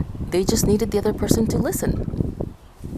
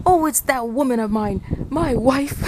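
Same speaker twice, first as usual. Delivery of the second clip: higher voice, dismissive; imitating a grumbling husband; 7 semitones higher than usual. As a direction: mimic the man explaining to a stranger. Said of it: "he said".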